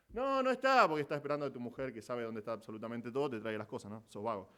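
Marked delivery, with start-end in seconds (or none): 0.1-0.9 whiny husband. Retold or imitated. Lazy husband whining to wife.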